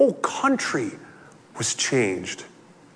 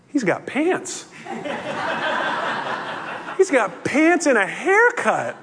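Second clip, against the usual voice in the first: breaking into real laughter, higher; laughing in disbelief; 10 semitones higher than usual. Real, not acted. Preacher laughing at the healed madman's new look. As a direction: laugh through the words as the joke lands.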